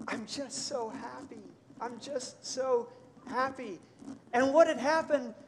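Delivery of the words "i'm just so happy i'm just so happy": lightly voiced child, higher, slowing on the repeat; a hint of a choked-up kid; overwhelmed little boy. Boy tells his parents why he cried, mild tearful child voice. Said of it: "finally he composes himself well enough to say".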